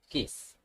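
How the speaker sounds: "Kiss" softly, with the K relaxed toward G; "Kiss" starting between G and K. Shown as a demonstration. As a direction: ease off the K in "Kiss". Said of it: "letter K but relax".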